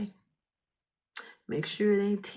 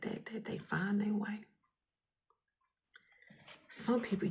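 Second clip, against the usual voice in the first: heartfelt whisper; 5 dB quieter than usual. Real, not acted.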